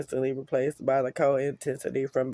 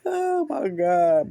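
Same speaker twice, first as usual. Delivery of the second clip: laughing; 5 semitones higher, 5 dB louder, much slower than usual. Real, not acted. Laughing about the scream.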